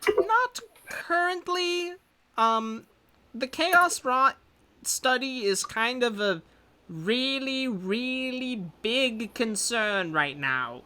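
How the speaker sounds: scholar NPC voice. Acted. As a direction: speak up high and measured as the scholar.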